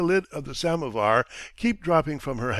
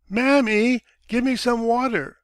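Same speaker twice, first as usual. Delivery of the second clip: put-on high, loud little boy calling for his mother; a young son crying out for water from another room; child's call; 10 semitones higher, 6 dB louder than usual. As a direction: call out like a small thirsty child. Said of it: "he hears his son's voice".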